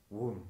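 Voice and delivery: imitated shooting-star whoom; whoosh noise. Picasso painting a shooting star, imitated whoosh. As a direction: swoosh it past with the mouth. Voicing the painter's strokes aloud.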